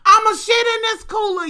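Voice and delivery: high shouted threat; hollering. Retold or imitated. Auntie hollering a threat at the cousin, high shout. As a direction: yell it out loud. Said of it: "Then she motherfucking said".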